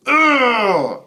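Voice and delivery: loud exasperated ugh; frustrated groan-shout. Real, not acted. Streamer fed up with viewers, exasperated groan. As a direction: let out the frustration.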